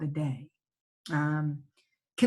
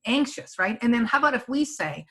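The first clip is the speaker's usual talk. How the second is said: ordinary talk, nothing marked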